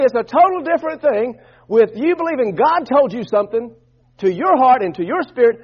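ordinary talk, nothing marked

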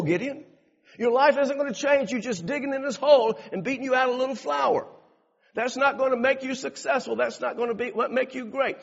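high, loud impassioned preacher voice; full-force preaching. Acted. Preacher voicing God's call to Gideon, impassioned. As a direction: urge them on, pulpit fire.

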